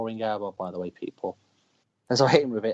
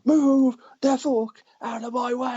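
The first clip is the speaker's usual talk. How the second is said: high, slow wailed order; mock drawn-out yell; 12 semitones higher, 4 dB louder, much slower than usual. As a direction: re-enact bellowing someone aside, stretching the words. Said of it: "he was like".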